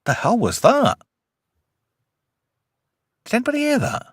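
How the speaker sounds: high, puzzled alarm; startled. Real, not acted. Host startled by a noise on the recording, high alarm. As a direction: ask as if something spooked you. Reacting to odd background sound.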